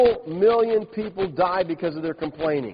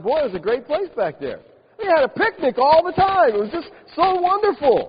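put-on high, louder gushing over the good old days; mock nostalgic Israelites; 10 semitones higher, 4 dB louder than usual. Preacher mocking Israelites longing for Egypt, gushing high. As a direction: ham up the whiners' rosy memories. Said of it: "Egypt sound like".

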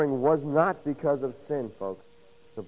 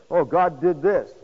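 mock dismay; 2 semitones higher, 6 dB louder, slower than usual. Mimics people's reaction to such talk.

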